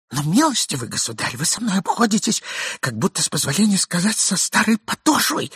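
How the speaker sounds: high pleading voice; meek despair. A narrator's reading. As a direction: beg meekly. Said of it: "кроткого отчаяния, голосом, в котором было слышно моление".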